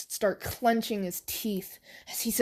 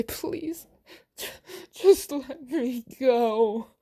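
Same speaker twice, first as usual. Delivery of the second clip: pleading; 4 semitones higher, much slower than usual. Acted. Captive pleads.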